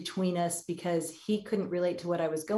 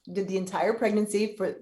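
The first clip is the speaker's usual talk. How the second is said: normal talking voice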